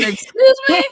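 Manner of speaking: high, loud shocked squeal; shocked excuse me. Retold or imitated. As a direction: shriek it in disbelief. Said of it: "I said".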